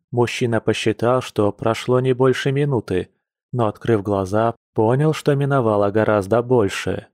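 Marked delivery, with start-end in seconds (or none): none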